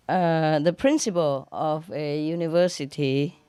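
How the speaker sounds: ordinary talk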